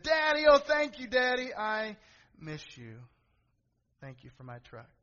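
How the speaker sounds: put-on a child's grateful cry trailing off; boy calling up to Daddy; little boy's thanks.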